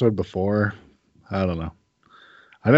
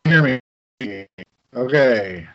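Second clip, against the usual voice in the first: choppy call audio, words dropping; broken-up voice over Skype; connection cutting out; 2 semitones higher, 4 dB louder than usual. Real, not acted. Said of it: "random little breaks of you shouting".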